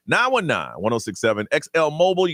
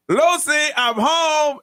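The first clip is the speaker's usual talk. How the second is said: high, playful sing-out greeting; calling out a homecoming; 11 semitones higher, 3 dB louder, much slower than usual. Acted. Host arriving at the front door, calling out high. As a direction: call it through the house, pitched way up. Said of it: "walk to the front door and go".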